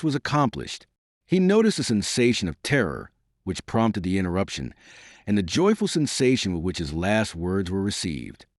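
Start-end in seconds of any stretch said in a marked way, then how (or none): none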